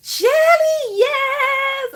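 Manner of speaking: cheering a viewer in chat; excited call. Real, not acted. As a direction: shout the name out with joy.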